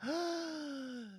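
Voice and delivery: shocked gasp. Real, not acted. Gasp at realizing age.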